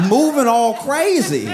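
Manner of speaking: ordinary talk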